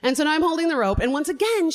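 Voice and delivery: ordinary talk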